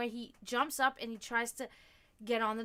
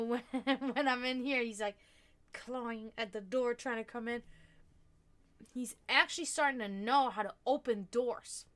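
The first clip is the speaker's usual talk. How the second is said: ordinary talk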